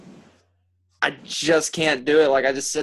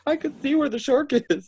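mocking high woman voice; 12 semitones higher than usual.

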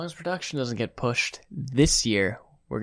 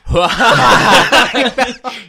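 big, high mock laugh; loud put-on laugh of relief; imitated relieved laugh; 10 semitones higher, 14 dB louder than usual. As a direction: burst out in a loud, hammed-up laugh. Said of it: "gives up, he's like".

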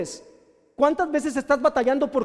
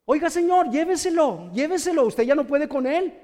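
higher, urgent-sounding summons; mock calling out; 3 semitones higher than usual. Parent asking a man to take the child, mock calling out. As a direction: call a stranger over, play-acted.

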